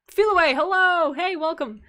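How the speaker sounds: high, loud hello to a newcomer; excited greeting. Real, not acted. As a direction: call out a big welcome. Greets arriving viewer mid-sentence.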